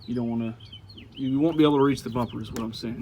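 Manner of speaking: ordinary delivery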